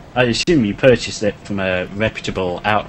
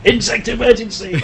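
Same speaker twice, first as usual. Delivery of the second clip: mock alarm shout; 11 semitones higher than usual.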